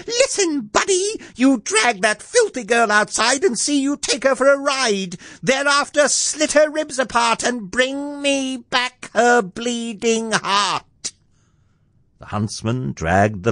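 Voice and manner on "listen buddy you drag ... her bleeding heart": very high, screechy commanding shout; shouting wicked queen. A narrator's reading. Queen ordering the huntsman to kill, high angry shout. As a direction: order it in a high shriek, drawing out the end. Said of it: "She shouted at him".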